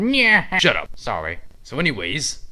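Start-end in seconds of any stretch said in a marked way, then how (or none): 0.0-0.6 high, louder cartoon jeer; taunting nyah. Villain boasts of his evil plan, mocking nyah. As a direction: gloat over the evil plan.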